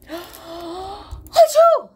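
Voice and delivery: hammy loud sneeze; big fake sneeze. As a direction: gasp then loud achoo. Acting the bear's sneeze.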